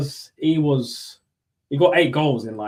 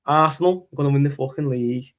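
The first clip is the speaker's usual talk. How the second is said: put-on Liverpool accent; football fan ranting in a hammed-up Scouse accent; 2 semitones higher than usual. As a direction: play a Scouse football pundit. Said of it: "your Scouse accent's pretty decent".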